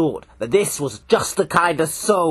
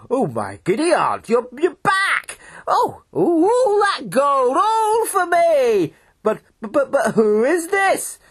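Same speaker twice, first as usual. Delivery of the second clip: excited farmer; 11 semitones higher, slower than usual. Acted. Farmer overjoyed at the gold.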